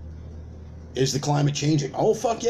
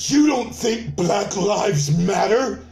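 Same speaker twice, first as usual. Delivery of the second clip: slow, high shocked question; mock outraged accuser; 5 semitones higher, 3 dB louder, much slower than usual. Activist turning on a doubter, slow mock outrage. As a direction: drag it out, scandalised.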